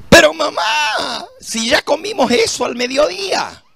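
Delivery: put-on very high, pleading child voice; whiny child protest. Boy complaining to his mother about the same plate. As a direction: whine like a boy at the dinner table. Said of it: "child protesting to mother".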